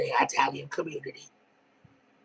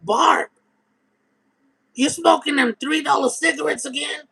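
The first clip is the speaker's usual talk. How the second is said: high, loud mock of the trailer neighbor; 11 semitones higher, 4 dB louder than usual. Neighbor mocking Barb over cheap cigarettes, high snide voice. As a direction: sneer it like the nosy neighbor.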